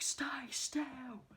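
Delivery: sharp, high barked command; mock shouted order. Villain ordering his captive to hold still: high barked command. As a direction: snap the order at her from up high.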